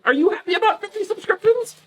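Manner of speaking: high pet voice. Real, not acted. Streamer asking her dog what it's up to, high pet voice.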